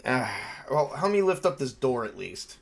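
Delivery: normal talking voice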